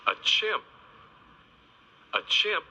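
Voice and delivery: bright high chimp; playful high repeat. Shown as a demonstration.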